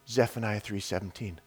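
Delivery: soft, low near-whisper; hushed voice of God. Acted. God answering on a prayer walk, hushed low voice. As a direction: say it low, like an inner voice. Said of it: "I just hear".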